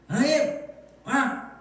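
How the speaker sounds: high, sharp cry to God; hammed-up indignant outburst; mock railing prayer. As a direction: demonstrate how not to pray. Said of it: "막 이런 거 말고요".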